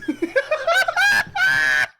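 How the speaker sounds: shrieking laugh, pitch jumps high; loud burst of laughter. Real, not acted. Sees stream title.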